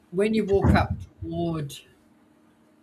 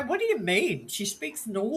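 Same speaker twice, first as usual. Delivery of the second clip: higher-pitched mock bafflement; retold puzzled protest; 6 semitones higher than usual. Child answering schoolmates about their mother, mildly indignant.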